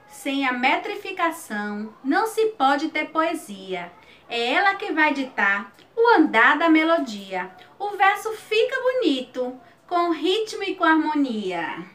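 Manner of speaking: measured, rhythmic cordel recitation; poem recital. Shown as a demonstration. Teacher reading a cordel stanza to students, rhythmic recital. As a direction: read the verse with a steady rhythmic lilt.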